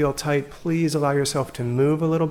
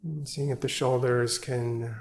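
guided meditation; 4 dB quieter than usual. Acted. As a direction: ease them into stillness, gently and unhurried. Leads evening sit meditation.

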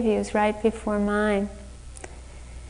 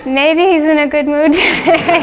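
higher, louder, laughing through the hope; nervous hopeful laugh; 7 semitones higher, 6 dB louder than usual. Retold or imitated. Teacher bracing for a stern interview, nervously laughing. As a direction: laugh it off while bracing for a scolding. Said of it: "preparing myself for the next blow".

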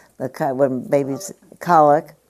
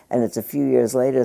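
ordinary talk, nothing marked

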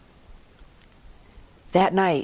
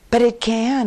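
higher and louder, firm insistence; insisting; 7 semitones higher, 6 dB louder than usual. Acted. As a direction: farmer insists to merchant his ox can do it, pushing back hard. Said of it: "insisted the Brahmin farmer".

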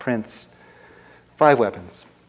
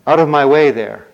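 loud, raised heroic command; storyteller's brave prince calling out; bold challenge; 6 dB louder than usual. Acted. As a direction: order a monster aside, loudly.

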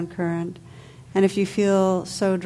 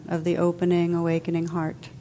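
ordinary talk

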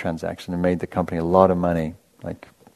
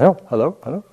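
put-on endearing Indian accent; 8 semitones higher, much faster than usual. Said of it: "in that very endearing accent".

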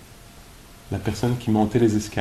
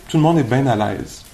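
ordinary talk, nothing marked

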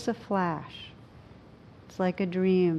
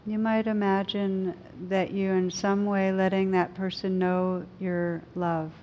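guided meditation. Acted. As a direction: gently guide them inward.

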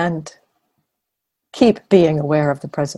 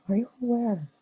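lightly lifted, higher question, falling away at the end; gentle, light reminder; 7 semitones higher, 4 dB quieter, faster than usual. Shown as a demonstration. Teacher reminding meditators to connect to awareness, gently and lightly. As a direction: ask it as a soft, light nudge. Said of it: "Gentle, light reminder".

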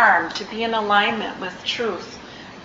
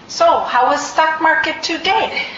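high, loud playful imitation of a Tibetan teacher's accent; imitating teacher's accent; 7 semitones higher, 5 dB louder than usual. Teacher greeting retreat students in his accent, imitated. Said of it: "which I'll try to imitate but do poorly".

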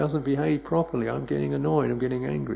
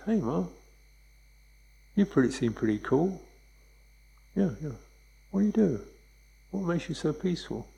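lightly voiced son; 5 semitones higher than usual. Son voiced talking to mother.

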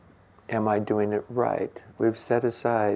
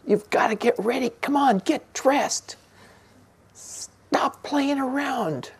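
high strained mock nagging, slowing down; imitating yelling at the kids to get ready; imitating a frazzled parent yelling; slower than usual. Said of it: "so tired of screaming at them".

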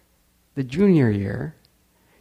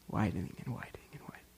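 whisper; 2 semitones lower, 18 dB quieter, much faster than usual. Acted. Smile growing, whispered.

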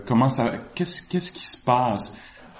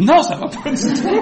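high, loud mock protest through laughter; très énervé; 8 dB louder than usual. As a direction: burst out in laughing, hammed-up indignation. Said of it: "moi, j'étais très énervé". (protestation indignée, forte et aiguë, en riant)

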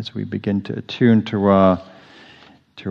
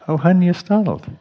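much higher, tender cooing; sweet, affectionate; 10 semitones higher than usual. Shown as a demonstration. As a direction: soothe yourself like a loved one. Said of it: "It's a very sweet".

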